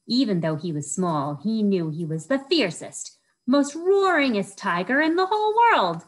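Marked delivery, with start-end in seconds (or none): 2.3-6.0 louder and higher, building to a big WORLD; excited. A narrator's reading. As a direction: boast it grandly, loud and high. Boasting about the tiger.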